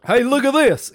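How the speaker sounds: mock call.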